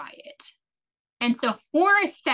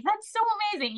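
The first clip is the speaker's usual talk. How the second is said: 1 delighted doctor; 10 semitones higher, much faster than usual. Retold or imitated. Doctor's delighted reply retold.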